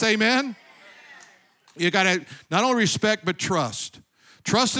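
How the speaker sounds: loud, high, driving preacher voice; preaching at full force. Acted. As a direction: lift the church up, full force.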